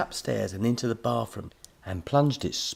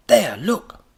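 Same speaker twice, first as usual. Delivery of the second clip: excited; 11 semitones higher, 11 dB louder than usual. Acted. Professor shows screen excitedly.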